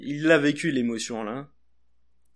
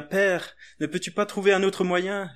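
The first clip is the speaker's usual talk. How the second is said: a mild acted cry, higher; 5 semitones higher, 4 dB louder than usual. Jesus crying out to his Father in the garden, mild acted cry. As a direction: call out to the Father, pleading. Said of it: "Il cria".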